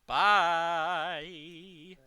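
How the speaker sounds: loud, high taunting bye; mocking goodbye. Real, not acted. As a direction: shout a cheeky farewell. Taunting a mob in game.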